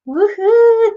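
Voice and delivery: high, loud happy whoop; excited cheer. Real, not acted. Host cheering a viewer from Florida with a loud high whoop.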